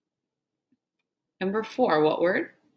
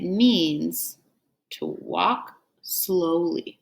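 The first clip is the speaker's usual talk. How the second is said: slowed to show meaning; much slower than usual. Shown as a demonstration. Teacher acts out word meaning.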